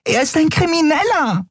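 very high-pitched, outraged protest; shrill alarm. A narrator's reading. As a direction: screech it in indignant disbelief. Shrill Yadi continues her protest. (schrille Empörung)

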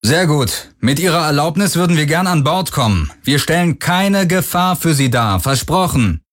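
high, firm hail; a leader calling out a promise; firm leader's voice. A narrator's reading. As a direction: pitch it up and assure them firmly. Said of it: "seine Stimme fest".

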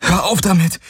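high, sharp cry to stop; desperate furious protest; angry outburst. Acted. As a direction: lash out at the phantom. Galen rejects the illusion.

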